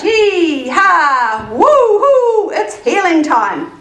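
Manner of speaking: high, loud cheering with stretched words; jubilant whooping. Acted. Friends rushing to Jesus' house, jubilant whooping. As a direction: whoop like you're racing off to celebrate.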